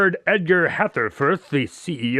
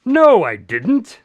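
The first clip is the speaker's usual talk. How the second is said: startled denial; 5 semitones higher, 5 dB louder, much slower than usual. Acted. Watson startled by the news of the sale.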